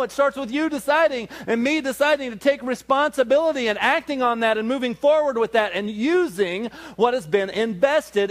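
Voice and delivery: fiery pulpit call to change now; preaching at full force. Acted. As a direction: rouse the congregation to act today.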